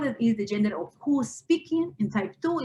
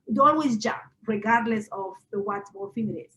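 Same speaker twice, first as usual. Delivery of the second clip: normal talking voice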